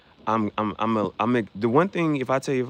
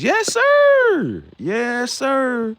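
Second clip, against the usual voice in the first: much higher, loud excited shout; hyped yes sir; 13 semitones higher, 6 dB louder, much slower than usual. Real, not acted. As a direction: cheer it out proudly. Hyped about shoes.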